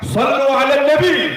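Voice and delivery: high-pitched call to a crowd; imitated shout. Preacher imitating a passer-by shouting at two quarrelling drivers, shouted call. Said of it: "orang ini cukup teriak".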